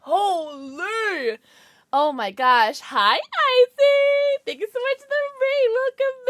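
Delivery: loud, high squeal of welcome; thrilled shouting. Real, not acted. Streamer thanking Icy for the raid, thrilled shout. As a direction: shout out thanks, overwhelmed and delighted.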